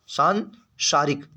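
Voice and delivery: सांसारिक split as "saan... saarik"; very slow dictation of सांसारिक with a gap; slow syllable-by-syllable. Shown as a demonstration. Teacher working out the spelling, सांसारिक slowly broken in two.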